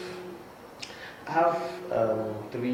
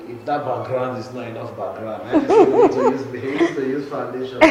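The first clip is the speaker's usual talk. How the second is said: words breaking up in laughter, pitch jumping much higher and louder; laughing; 3 semitones higher, 8 dB louder than usual. Real, not acted. Guest jokes to the host about not enough background, laughing. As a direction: crack up mid-sentence at your own joke.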